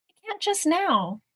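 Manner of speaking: high little voice; high-pitched storybook excuse; excuse-making mouse. Acted. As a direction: beg off as a tiny mouse.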